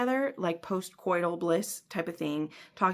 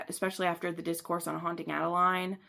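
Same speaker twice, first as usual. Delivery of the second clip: ordinary talk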